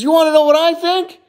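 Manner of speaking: put-on high, loud, insistent kid; little boy. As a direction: pester Mom, pitched right up. Little boy pesters his mom.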